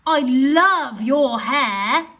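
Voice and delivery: loud, slow, drawn-out delight on love; excited gush. Shown as a demonstration. Teacher reading the exclamation version of the sentence with feeling. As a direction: rave about someone's hair. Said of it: "with an exclamation mark".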